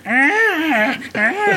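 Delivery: very high imitated whiny scream; mock a whiny little shriek; squealed, high-pitched mock scream. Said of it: "his whiny little scream".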